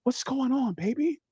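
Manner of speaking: soft, high, coaxing voice; tender mom. Acted. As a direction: gently coax a child at their level. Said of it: "get down on your knees to get her".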